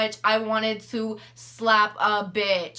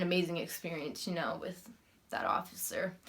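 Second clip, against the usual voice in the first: whispered, much lower and softer; sarcastic whisper; 5 semitones lower, 11 dB quieter than usual. Real, not acted. Mocking the officer's "amazing" encounter, whispered. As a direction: breathe it out with dry sarcasm.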